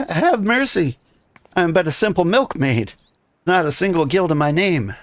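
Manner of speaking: hammed-up high milkmaid plea; frightened milkmaid. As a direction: beg for mercy as a simple milkmaid. Player voices frightened milkmaid.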